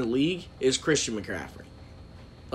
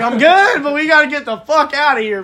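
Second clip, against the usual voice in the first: urgent; 9 semitones higher, 7 dB louder than usual. Retold or imitated. Retelling crash, urgent getaway.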